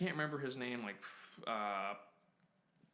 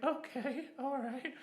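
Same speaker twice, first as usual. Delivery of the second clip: mock nervous squeak; 12 semitones higher, slower than usual. Nervous agreement to absurd deadline.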